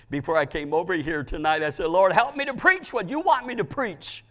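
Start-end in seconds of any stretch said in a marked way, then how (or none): 1.9-4.2 raised, high, loud plea; fervent prayer. Retold or imitated. Preacher retelling the prayer said before coming tonight, fervent. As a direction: call it out to God, high and strong.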